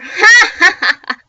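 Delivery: high, very loud booming evil laugh. A narrator's reading. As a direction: let the cackle swell. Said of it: "It grew louder and louder".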